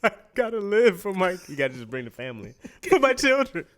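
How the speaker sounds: high, laughing, put-on plea for the children; mock dramatic plea. Pleading to followers she must eat fish, high laughing mock plea. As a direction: ham it up like a tearful parent.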